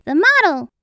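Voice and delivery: excited high call; crowd shout. A narrator's reading. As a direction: shout the star's name like a crowd. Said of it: "All the animals shouted".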